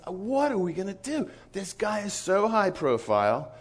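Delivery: high-pitched mock worry; Wes's worried voice. Missionary asking his leader about the high-profile guy, mock worry. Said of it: "And so Wes says".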